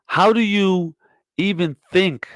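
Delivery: ordinary delivery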